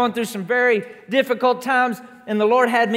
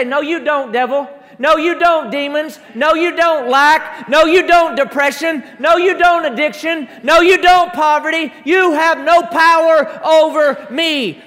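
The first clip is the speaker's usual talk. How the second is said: repeated "No, you don't" shouted louder each time; building shout; 5 semitones higher, 7 dB louder than usual. Acted. Preacher shouting down devil and demons, building. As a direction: drive the devil off, climbing to a full shout.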